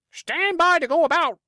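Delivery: high, loud squawking parrot cry; parrot scream. Acted. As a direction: screech it like a parrot. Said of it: "the parrot would scream".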